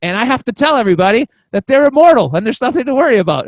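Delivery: loud, high excited declaration; urgent proclamation. Retold or imitated. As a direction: proclaim it to everybody. Retells urge to tell everybody.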